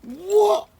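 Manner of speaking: high, loud cry of disbelief; shocked cry. Real, not acted. Opening the parcel and surprised by an item, crying out.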